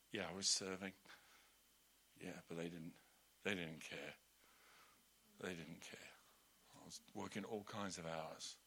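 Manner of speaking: soft, low sulky grumble. Retold or imitated.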